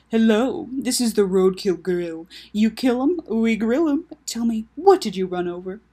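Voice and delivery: playful mock diner spiel; joke phone greeting. Taco answering an unknown caller, jokey sales patter.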